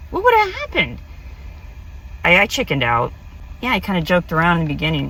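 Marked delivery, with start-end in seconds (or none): 0.1-1.0 "What would have happened?" lifted high and wistful; wondering aloud, pitched up. Real, not acted. Repeating her regret about the missed ride, high and wistful. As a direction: ask it again, much higher, dreamily.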